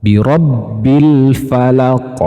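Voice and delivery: slow chanted bi rabbil falaq; slow model recitation; qaf example. Shown as a demonstration. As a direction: recite slowly, stressing qaf. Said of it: "pada huruf qaf".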